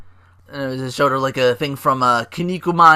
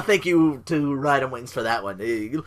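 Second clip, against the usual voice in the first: ordinary talk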